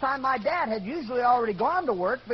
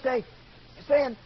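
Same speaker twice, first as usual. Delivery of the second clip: usual speaking voice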